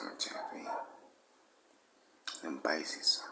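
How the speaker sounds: whispering. Acted. Whispered aside to the guys.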